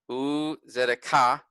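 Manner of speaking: slow u tzedakah, final "kah" stressed; stress on last syllable. Shown as a demonstration. Teacher showing where the accent falls, last syllable stressed. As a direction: draw out u tzedakah and land on "kah". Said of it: "put emphasis on the last syllable".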